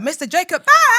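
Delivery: loud, high hype announcement of the name; big guest intro. Acted. Host introducing the guest to listeners, hyped announcer call. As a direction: bring him on like a show host.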